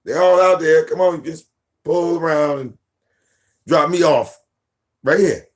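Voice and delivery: lightly voiced daughter; bossy daughter. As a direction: order him around like a spoiled child. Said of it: "He's like".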